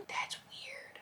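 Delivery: unvoiced, hushed whisper; whispered aside. Real, not acted. Aside after retelling.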